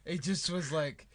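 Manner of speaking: ordinary delivery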